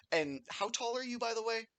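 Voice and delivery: high, sweet, curious voice; pry gently like a motherly barmaid; kindly older lady. Acted. Barmaid asking a guest's height, kindly older lady.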